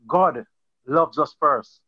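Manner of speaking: ordinary delivery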